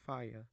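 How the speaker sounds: faya, dropping the r like RP; non-rhotic fire. Shown as a demonstration. Teaching English sounds, says fire as faya with no r. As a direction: fire said as faya, no r, ending in a schwa. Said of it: "For example".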